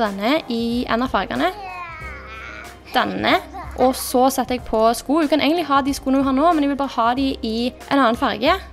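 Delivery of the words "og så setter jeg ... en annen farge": normal talking voice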